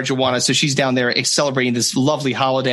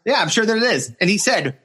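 ordinary talk, nothing marked

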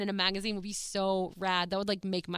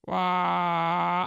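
a half-hearted imitated horror sting; a quick try at the scary movie sound effect; movie scare sound; 3 semitones lower, 4 dB louder than usual. Describing the Nun's scariest sound effect, a weak first imitation. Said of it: "I can't even do it like he does".

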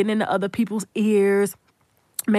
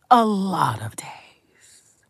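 a lot of days, very slow and whispered; slow emphatic whispered echo; 3 semitones lower, much slower than usual. Real, not acted. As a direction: drag the repeat out in a whisper.

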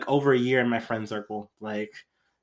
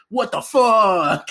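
mock outrage; 8 semitones higher, 5 dB louder, much slower than usual. Mocking the show's gotcha reveal.

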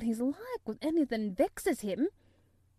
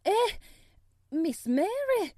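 high, loud, near-tearful pleading; plead, close to tears and frightened; a frightened maid begs, high and loud, near tears; half crying; 7 semitones higher, 8 dB louder than usual. A narrator's reading. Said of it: "she said, half crying".

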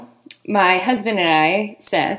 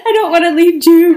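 put-on high, louder baby-talk pet voice, with a laugh; dog voice; 8 semitones higher, 7 dB louder than usual. Owner not wanting to leave her dog, in high dog voice. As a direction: whine it sweetly like to a puppy. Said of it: "We have this dog voice".

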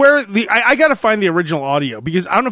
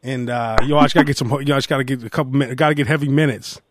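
Jeremy Lin impression; 5 semitones lower than usual.

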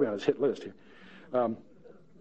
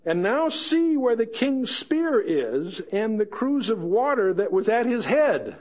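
higher, slow, savouring reading; triumphant reveal reading; 6 semitones higher, slower than usual. Acted.

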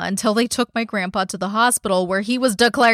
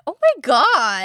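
slow, drawn-out gasp of shock; 7 semitones higher, 3 dB louder, slower than usual. Real, not acted. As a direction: let the shock stretch out. Shocked by the story twist.

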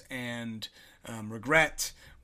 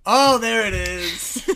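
slow, louder exclamation of discovery; slow, louder delighted "there it is"; delighted catch; 5 semitones higher, 9 dB louder, much slower than usual. Real, not acted. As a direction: pounce on the accent. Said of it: "I do say bag".

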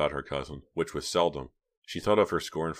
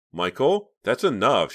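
louder, higher firm rebuke; sharp; 7 semitones higher, 8 dB louder, slower than usual. A narrator's reading. Reva stopping her brother, sharp and firm. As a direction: cut him off firmly. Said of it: "she said sharply".

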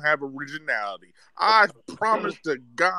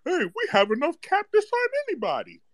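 higher, mock-naive fan voice; 14 semitones higher than usual. Mocking an overnight caller's hopeful cap talk, in a higher mock voice. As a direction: mimic the clueless fan who missed the point. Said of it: "talking about".

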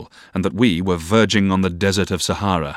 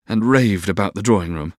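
ordinary delivery, nothing marked

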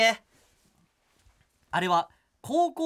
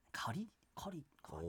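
whispering; 8 semitones lower, 19 dB quieter than usual. Real, not acted. Mumbling the word to herself.

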